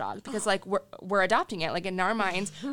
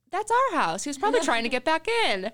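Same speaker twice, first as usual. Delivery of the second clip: mock shock; 7 semitones higher, 4 dB louder than usual. Owners reveal the truth.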